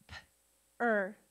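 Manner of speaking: P-er as a puffed p, pause, then er; sounded out. Shown as a demonstration. Teacher sounding out a syllable with students, P-er in two sounds. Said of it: "Sound it out with me".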